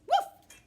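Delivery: short, loud, high woof; dog bark. Acted. Girl playing a dog barks.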